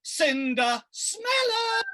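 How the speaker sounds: high, drawn-out mocking jeer; shrill taunt. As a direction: sneer it out high.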